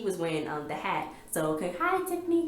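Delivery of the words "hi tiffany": chirpy shout-out. Real, not acted. Shout-out greeting.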